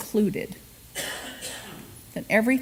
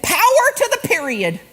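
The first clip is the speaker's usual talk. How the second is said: emphatic quote; 9 semitones higher, 5 dB louder than usual. Retold or imitated. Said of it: "And she said".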